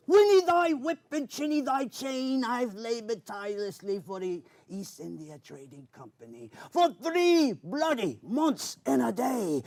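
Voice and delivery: booming pirate declamation, high and loud; Captain Barbossa pirate voice. Acted. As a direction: roar it like a pirate captain on deck. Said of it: "characters was Captain Barbosa".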